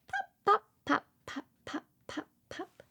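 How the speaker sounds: pops fading away to nothing; playful pop sounds. Acted. Mother pops pretend bubbles for her baby, fading away. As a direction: let the pops drift off.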